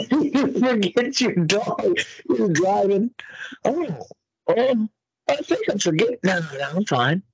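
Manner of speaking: high, put-on voice of a clueless owner; mock forgetful owner. Mocking the forgetful dog owner.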